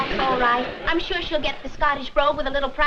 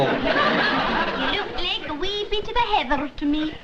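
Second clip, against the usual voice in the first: put-on Scottish accent; slower than usual. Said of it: "have a go at a Scottish accent".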